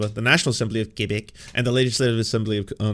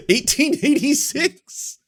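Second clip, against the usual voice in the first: very high, slow theatrical reading; a high, drawn-out mock proclamation; mock pompous orator; 14 semitones higher, slower than usual. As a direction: ham it up like an old-time speaker.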